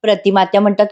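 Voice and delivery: usual speaking voice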